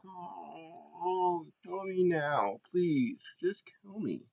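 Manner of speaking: high, soft, theatrical plea to be put out of misery; mock despair. Her favourite loses again.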